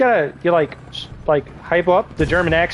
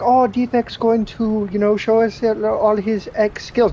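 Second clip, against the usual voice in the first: mock fan voice; 6 semitones higher, slower than usual. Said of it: "you guys are gonna be like".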